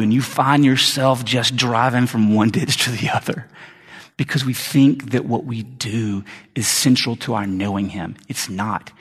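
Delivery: ordinary talk, nothing marked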